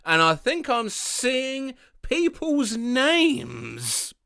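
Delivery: slow, dazed tripping stranger. Retold or imitated. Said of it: "came up to me at a bar once and asked me".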